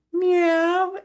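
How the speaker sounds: very high squeaky cat meow; a tiny kitten mewing up high; kitty meow. Acted. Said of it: "And he said".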